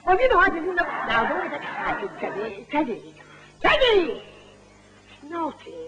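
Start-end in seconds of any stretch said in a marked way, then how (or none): 3.6-4.2 much higher, louder call of the name; shout. Real, not acted. Ventriloquist shouting at Teddy to stop him, loud call. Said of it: "I won't shout anymore". 4.2-5.7 whisper. Real, not acted. Scolding the bear after shouting.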